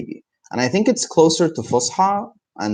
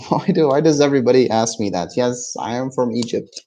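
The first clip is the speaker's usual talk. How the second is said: normal talking voice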